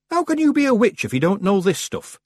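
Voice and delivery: high-pitched village baker voice; scornfully puzzled shopkeeper; incredulous baker. A narrator's reading. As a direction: ask in disbelief, talking down. Baker doubts the young witch.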